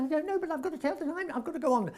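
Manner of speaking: high, rushed, insistent plea; retold urgent plea. Actor begging director for watch before curtain, retold urgent plea. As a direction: rush it out high, as if due on stage.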